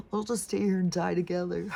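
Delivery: mock-pitiful "we're doomed" voice; voicing resigned dog. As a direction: speak for the hapless dog, amused. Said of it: "He's like".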